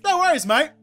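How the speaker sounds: fake friendly. I can be like.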